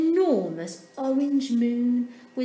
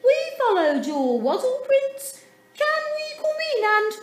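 very high, louder little penguin voices; little sisters; 8 dB louder than usual. A narrator's reading. Sisters knock at Dudley's door, very high. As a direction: chirp it up high like small sisters.

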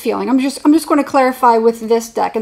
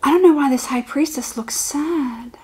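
whisper; 3 semitones higher than usual. Real, not acted. Whispering while reading the card.

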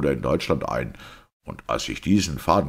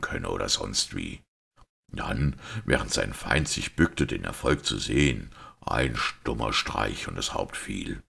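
lower-pitched, restrained priest voice; composed priest; 5 semitones lower than usual. Acted. Priest asking everyone to hear him out, composed. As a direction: play a priest calmly setting out the truth.

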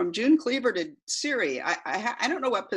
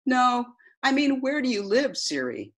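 repeating to voice assistant; 6 semitones higher than usual. Acted. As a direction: spell it out for the phone assistant. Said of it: "this is the transcript of that conversation".

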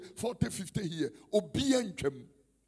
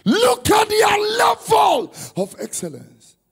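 loud, high preaching with a crying edge; impassioned preaching; 4 semitones higher, 10 dB louder than usual. Acted. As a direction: preach it with rising passion.

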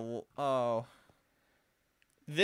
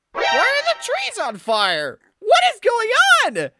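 What is going on said much higher and louder, panicked; panicked shriek; 11 dB louder than usual. Real, not acted. Player shrieking at the burning forest, panicked. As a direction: shriek the question in alarm. Trees suddenly on fire.

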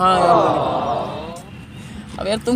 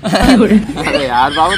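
laughing; much slower than usual. Real, not acted. Laughing while coaxing upset girlfriend.

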